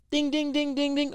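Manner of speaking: high, sing-song game-show bell; ding ding bell. Pretend. Coach hitting the answer, sung bell chime.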